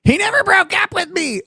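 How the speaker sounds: very high parody of the ex; mocking high-pitched protest; mock caller impression. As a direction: echo her complaint in a high put-on voice.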